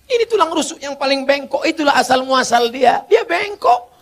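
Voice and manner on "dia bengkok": mock squeak.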